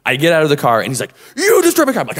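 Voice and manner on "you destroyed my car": high mock yell of the angry man. Imitating the angry owner yelling about his car. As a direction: shout it like he's furious.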